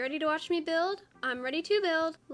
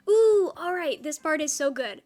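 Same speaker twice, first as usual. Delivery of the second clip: loud delighted ooh; excited; 8 dB louder than usual. Real, not acted. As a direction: gush with excitement. Excited about next part.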